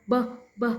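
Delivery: "b" as a firm short "buh"; voiced B. Shown as a demonstration. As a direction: sound "b" with the throat vibrating. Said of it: "does have voicing".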